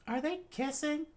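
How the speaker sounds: very high, shocked question; high horrified voice. Acted. Latla seeing the kiss, very high shocked question. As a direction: ask it aghast.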